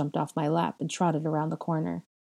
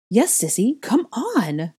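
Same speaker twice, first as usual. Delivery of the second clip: high, loud, gleeful coaxing; playful and excited, pitch swooping up; giggly glee; 6 semitones higher, 7 dB louder, slower than usual. A narrator's reading. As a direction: egg your sister on with girlish delight. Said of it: "Bellatrix giggled".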